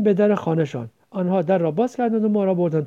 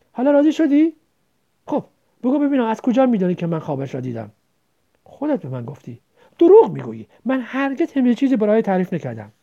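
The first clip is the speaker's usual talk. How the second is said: louder, higher resentful retort; hurt and angry; 5 semitones higher, 6 dB louder than usual. Acted. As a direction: snap back, wounded. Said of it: "دلگیرانه گفت".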